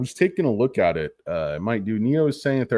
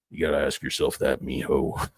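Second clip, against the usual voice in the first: put-on old star voice; 8 semitones lower, 4 dB quieter than usual.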